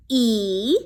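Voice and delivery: "e" as a long ee vowel; long e sound. Shown as a demonstration. Teacher showing the class E's long sound, "ee". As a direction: stretch the long "e". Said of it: "E can say".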